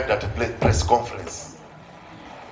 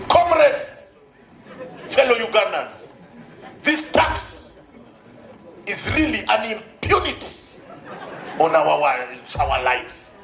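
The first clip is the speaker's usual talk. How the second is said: grand rally speech; slower than usual. Retold or imitated. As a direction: speak like a rally leader.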